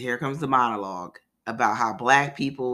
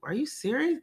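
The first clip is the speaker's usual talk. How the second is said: mock disbelief; 12 semitones higher than usual. Friend shocked the car's stolen.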